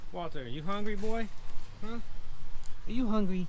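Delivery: high, bright questions to the buck; pet talk. Real, not acted. Asking Walter the buck if he's hungry, high pet voice. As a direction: coo the question up high to the animal.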